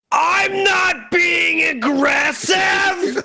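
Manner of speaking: full-force, high drawn-out scream; comically over-the-top shouted denial; aggressive denial. Acted. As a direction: bellow every word slowly at the top of your voice. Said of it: "you're sounding aggressive".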